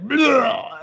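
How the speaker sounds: high, loud mock retching noise; imitated barf. Tony being sick in the bathroom, imitated barf. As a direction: make a cartoonish throw-up noise. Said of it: "like, initial barf".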